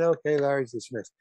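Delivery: understated announcement. Retold or imitated. Director dismissing the actor once it's over, understated announcement. Same announcement repeated, toned down.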